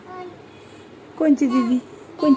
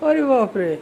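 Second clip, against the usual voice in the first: normal talking voice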